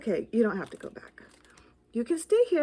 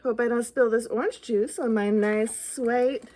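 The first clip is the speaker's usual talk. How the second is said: ordinary delivery, nothing marked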